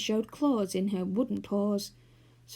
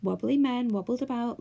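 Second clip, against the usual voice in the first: ordinary delivery, nothing marked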